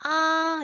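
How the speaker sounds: 아 held high on one level pitch; first tone. Shown as a demonstration. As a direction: sustain 아 high and even. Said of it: "일 성은 발음 어떻게 하지?". (아를 높고 평평하게, 일성으로)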